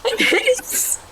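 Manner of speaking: high-pitched cry of horror; hammed-up shriek of self-disgust; horrified wail. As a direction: wail it in dismay at your reflection. Character sees the mirror, horrified.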